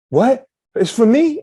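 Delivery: high, loud surprised question; a daughter's high gasp of disbelief; delighted surprise. Retold or imitated. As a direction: imitate his daughter seeing her name. She said.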